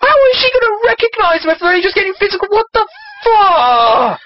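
high-pitched outraged rant; exasperated rant. Acted. Reader rails at the story's made-up word, exasperated.

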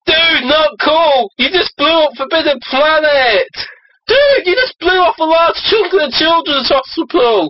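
very high-pitched exclaimed complaint; hammed-up shock, high all the way through; outraged protest. Teens scolding Greyhawk for destroying buildings, outraged protest.

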